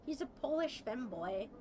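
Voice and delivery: ordinary talk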